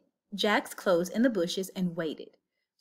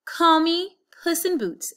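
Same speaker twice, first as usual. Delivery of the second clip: higher, grand cat introducing itself; proud cat; 8 semitones higher, 5 dB louder than usual. A narrator's reading. Cat proudly naming itself to Jack, grand. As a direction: announce the name proudly. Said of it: "said the cat".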